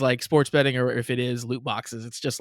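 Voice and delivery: ordinary delivery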